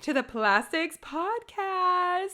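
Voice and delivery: high, drawn-out podcast title; singsong announcer-style flourish; playful sign-off. Real, not acted. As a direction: finish the outro in a long high lilt. Co-host finishes singsong sign-off.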